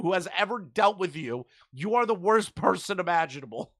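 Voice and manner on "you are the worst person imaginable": usual speaking voice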